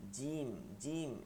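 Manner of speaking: জিম drawn out slowly with a firm j; hard jim. Shown as a demonstration. Teacher drilling the correct letter for students, slow hard j. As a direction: hold জিম long, tongue pressed to palate. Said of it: "শক্ত করতে হবে".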